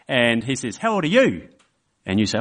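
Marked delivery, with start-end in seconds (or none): 0.8-1.5 put-on higher, lightly childlike question; curious little boy. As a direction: ask it like a nosy kid. Child voiced in story.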